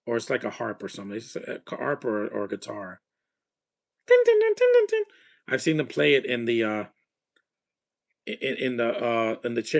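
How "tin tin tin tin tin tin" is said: very high, sharp plucking-string noises; ping each tin like a string being plucked; imitated plucked strings. Recalling train station musicians, imitating the zither with high plucked notes.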